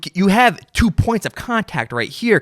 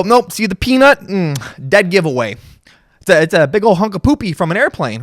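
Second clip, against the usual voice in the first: smug expert; 5 semitones higher than usual. Retold or imitated. Expert telling the finder it is airplane waste, high and smug. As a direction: deliver the verdict with smug glee. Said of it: "And then they go".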